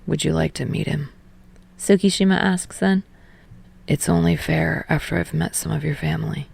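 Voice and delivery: a quiet low voice, a bit shy; Tsukishima voice. Acted.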